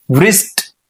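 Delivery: વ્રિસ્ટ said with an audible v before the r; mispronounced W. Shown as a demonstration. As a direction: sound the silent W in વ્રિસ્ટ, "vrist". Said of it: "વ્રિસ્ટ ન બોલાય". (વ્રિસ્ટમાં 'વ' બોલીને ખોટો ઉચ્ચાર)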